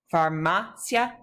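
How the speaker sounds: farmacia said slowly with MA stressed; stress on second A. Shown as a demonstration. Teacher shows where the accent is, slow stress on MA in farmacia. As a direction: stretch out far-MA-cia, cia as one syllable. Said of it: "second A of the second to last syllable".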